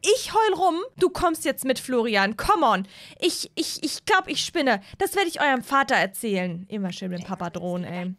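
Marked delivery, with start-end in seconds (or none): 0.0-2.9 higher-pitched disappointed mother scolding; higher mom voice telling the boys off. Acted. 3.2-6.6 scolding mom. Acted.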